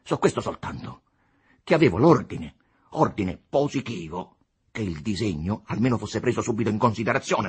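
agitated engineer, aggrieved. A narrator's reading. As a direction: press the grievance hard. Costa argues his case. (ingegnere agitato che protesta con forza)

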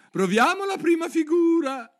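very high, loud exclamation; eager turtle. A narrator's reading. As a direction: squeal the suggestion with sudden eagerness. Turtle eagerly proposing the dance. (esclamazione acuta ed entusiasta)

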